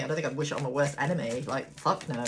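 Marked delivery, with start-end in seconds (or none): none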